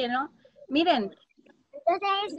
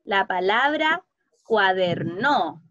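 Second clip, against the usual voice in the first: slow careful; 6 semitones lower, slower than usual. Shown as a demonstration. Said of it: "¿Cuál sería la sílaba tónica correcta".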